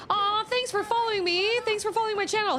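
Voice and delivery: high, sweet thank-you voice; cute thank-you. Real, not acted. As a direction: gush over the new follower. Thanking a new channel follower.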